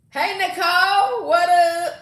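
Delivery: loud, high, drawn-out hello; calling out a greeting. Real, not acted. Host greeting a viewer on her live, calling out. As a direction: sing out a big welcome.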